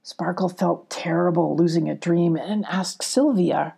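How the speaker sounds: usual speaking voice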